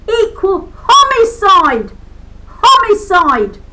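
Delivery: loud, high, slow proclamation; furious declamation; shouted slogan. Real, not acted. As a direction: bellow each syllable.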